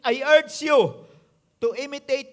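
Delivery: drawn out, loud and pleading; slow forceful urging. Acted. Preacher urging the church, slow and loud. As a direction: press the words home slowly to the congregation.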